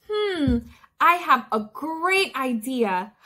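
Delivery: plain reading voice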